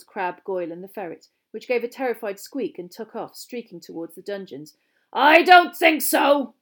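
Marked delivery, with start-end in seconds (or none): 5.1-6.5 slow, high, very loud roar. A narrator's reading. Teacher stopping the fleeing ferret, roaring. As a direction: roar it, drawing the words out. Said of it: "roared Moody".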